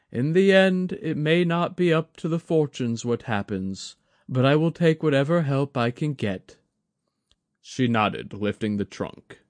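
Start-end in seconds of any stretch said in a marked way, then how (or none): 0.1-6.6 lightly voiced humble elder; a hint of a courteous old advisor; Maezawa, old man. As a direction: sound deferential and measured. Maezawa speaks.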